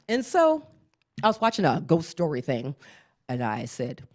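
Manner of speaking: ordinary talk, nothing marked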